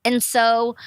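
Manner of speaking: ordinary talk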